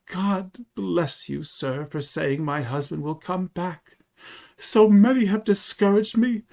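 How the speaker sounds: imitated emotional wife, high-pitched; retold tearful thanks. Shopman retells the wife's grateful blessing, mock tearful. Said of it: "she burst out crying".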